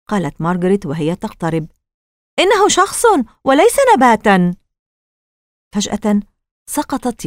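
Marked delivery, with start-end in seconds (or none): 2.4-4.6 high, urgent voice of discovery; startled realisation. Acted. Margaret edging closer to the figure, startled realisation. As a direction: exclaim it as you realise, pitched up.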